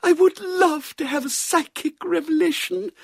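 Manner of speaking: put-on yearning, very high old-lady voice; long for it in a high, affected voice; wistful spiritualist spinster; elderly cousin. A narrator's reading. Elderly spiritualist cousin longs for revelation.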